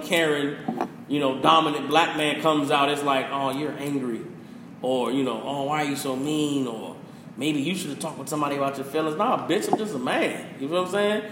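ordinary talk, nothing marked

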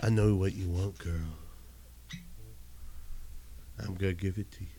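low, soft seductive murmur; sexy voice.

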